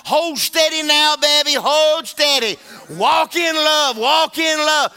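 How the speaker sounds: high, loud, slow urging; Holy Ghost urging. Acted. Holy Ghost urging the believer, loud and slow. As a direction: call out steady like a coach. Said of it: "Holy Ghost is gonna be on the inside saying".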